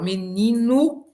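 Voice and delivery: "menino" devagar, terminando em nu; o final como u. Shown as a demonstration. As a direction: alongue "menino" destacando o u final. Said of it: "o o se transforma em u". ("menino" pausado, com o o virando u)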